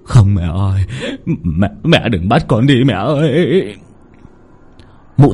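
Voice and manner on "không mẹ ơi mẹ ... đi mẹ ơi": panicked pleading. A narrator's reading. Son begs his mother's ghost not to take him. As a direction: beg in terror. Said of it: "hoảng hốt".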